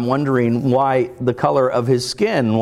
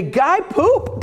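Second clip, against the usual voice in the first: put-on high excited child announcing; little kid voice. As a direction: squeal it like a toddler.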